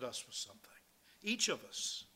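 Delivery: hushed confiding whisper. Real, not acted. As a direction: lean in and whisper it. Preacher's aside to congregation.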